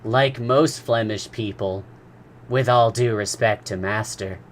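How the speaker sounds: a hint of a humble manservant; modest servant. A narrator's reading. Conseil answers modestly.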